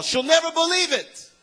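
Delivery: high, excited cry of amazement; excited exclamation. Retold or imitated. Convert's excited plea, acted out.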